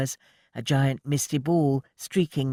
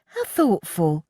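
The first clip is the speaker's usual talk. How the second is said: exclaiming; 8 semitones higher, slower than usual. A narrator's reading. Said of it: "she exclaimed".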